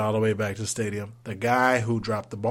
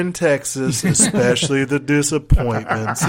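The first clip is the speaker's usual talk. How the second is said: sarcastic quip; 5 semitones higher, 9 dB louder than usual. Retold or imitated. Sarcastic quip while sitting there.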